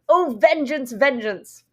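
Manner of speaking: declaimed vengeance, punched high then dropping; theatrical outcry. Acted. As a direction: cry it out as a wronged husband on stage. Acting out the raging monologue.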